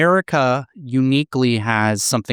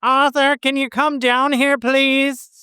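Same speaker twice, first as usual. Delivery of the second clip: Boston mom calling; 14 semitones higher, slower than usual. Pretend. Cohost, mocking Boston accents, calls Arthur downstairs in a high voice. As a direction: call up the stairs like a Boston mother. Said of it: "You sound like a duck".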